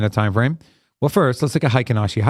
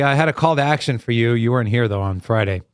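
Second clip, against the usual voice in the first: ordinary talk, nothing marked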